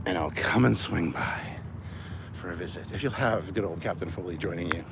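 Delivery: ordinary delivery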